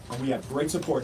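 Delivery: ordinary talk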